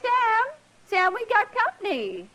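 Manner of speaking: calling out. Acted. Wife calls husband.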